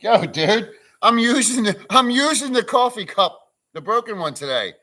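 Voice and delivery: high and loud, slowing on the cup; bursting to tell them about the cup; excited. Real, not acted. Streamer tells chat about his broken coffee cup, excitedly.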